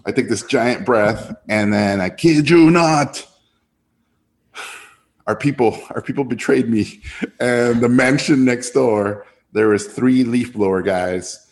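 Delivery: usual speaking voice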